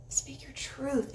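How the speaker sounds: slow breathy whisper, leaning in; soft whisper. Real, not acted. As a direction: whisper it slowly like a secret. Urging viewers to speak up.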